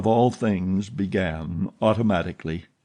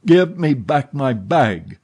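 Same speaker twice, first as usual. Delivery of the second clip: louder, slower demand, leaning on give; a man losing patience, louder and slower; insistent repeat; 5 semitones higher, 6 dB louder than usual. A narrator's reading. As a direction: hammer the repeat home, higher and louder. Erik repeats his demand, harder.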